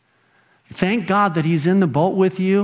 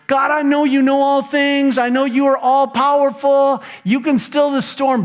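high, louder pleading to God; mock prayer of doubt; 9 semitones higher, 3 dB louder than usual. Preacher voicing his own doubting prayer. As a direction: voice a wavering prayer in the storm. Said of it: "I'm tempted in the midst of my storms to go".

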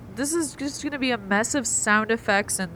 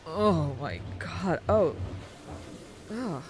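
gagging, about to puke; disgusted by smell. Acted. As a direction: retch at the smell. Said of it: "it smells awful".

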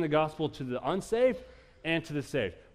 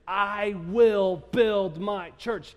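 "I will build my church" very slow, higher and louder on "will"; emphatic statement; 6 semitones higher, 6 dB louder, much slower than usual. Shown as a demonstration. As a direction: hammer "will" out word by word. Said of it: "it's an emphatic statement".

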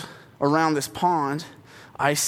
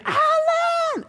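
screaming a name. Retold or imitated. Mom screaming for dad from the yard, panicked shout. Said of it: "she's just screaming".

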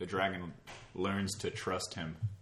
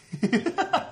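sudden high squeal of a laugh; odd high laugh; 8 dB louder than usual. Real, not acted. Friend mocks the odd accent-like laugh, high squealing laugh. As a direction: let a strange high laugh burst out. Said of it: "That sounds South African as hell".

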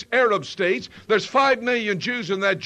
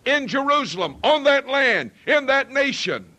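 loud and higher, fervent; preacher paraphrasing God's promise at full voice; preaching God's words; 4 semitones higher, 4 dB louder than usual. Acted. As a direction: shout the promise to the congregation. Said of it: "What he is saying is".

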